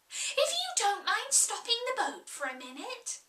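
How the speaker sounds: put-on fast, high girl's plea; Alice, eager. Alice asking the Sheep to stop, fast and high. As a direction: hurry the request out.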